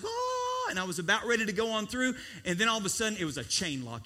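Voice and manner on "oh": a high joyful whoop; gleeful shout. Retold or imitated. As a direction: shout it like you're free. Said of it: "I was like".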